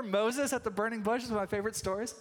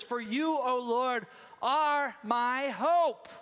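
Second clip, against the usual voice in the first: drawn-out, louder on hope; scripture reading; 4 semitones higher, 4 dB louder, much slower than usual. Acted. Preacher reading verse five to church, drawn out. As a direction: let hope ring out long.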